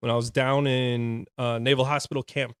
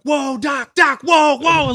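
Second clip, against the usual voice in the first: high, loud shouts to stop; mock corporal's high, urgent yelling; imitated alarmed intervention; 13 semitones higher, 10 dB louder, slower than usual. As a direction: call out urgently to calm someone down. Corporal intervenes in screaming match.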